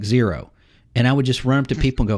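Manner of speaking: ordinary talk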